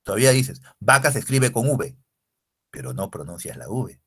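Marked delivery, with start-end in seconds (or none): none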